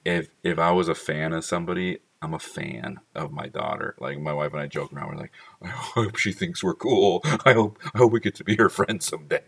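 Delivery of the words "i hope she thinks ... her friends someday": mock anxious hope. Joke with wife about daughter.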